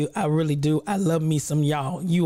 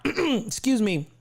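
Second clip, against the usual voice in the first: ordinary talk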